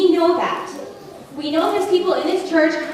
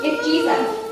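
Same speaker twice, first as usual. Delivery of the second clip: crying; 8 semitones higher, slower than usual. Real, not acted. Preacher's emotional climax.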